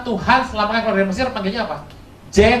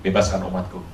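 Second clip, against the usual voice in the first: much lower, commanding divine voice; voice of God; 12 semitones lower than usual. Acted. God commanding Moses, in a much lower voice. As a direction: speak as God, low and grave.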